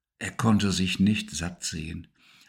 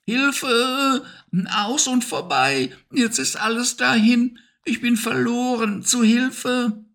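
much higher, frantic hamster cry; panicked cry for help. Acted. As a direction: call for help as if all is lost. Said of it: "schrie aus Leibeskräften". (viel höher, panischer Hilferuf)

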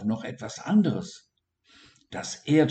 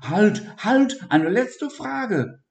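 high loud halt, then slow; high impatient dormouse calling; 12 semitones higher, 5 dB louder, slower than usual. A narrator's reading. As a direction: stop her once more, urgently. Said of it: "Siggi wurde ungeduldig".

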